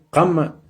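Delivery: Kama with a hard back K, said slowly; teacher saying Kama clearly; Totonac pronunciation. Shown as a demonstration. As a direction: model Kama for learners. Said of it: "si nos referimos a kama".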